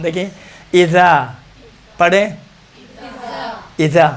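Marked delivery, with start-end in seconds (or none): 0.7-1.3 اذا with tongue between the teeth; thin th-like ذ in اذا; tongue-tip zaal. Shown as a demonstration. Teacher models for students to repeat, thin zaal. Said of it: "تو بیچ میں آتا ہے".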